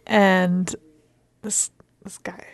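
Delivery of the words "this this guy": hushed whisper, barely voiced. Real, not acted. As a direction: whisper it so next door can't hear. Whispers about the noisy neighbour.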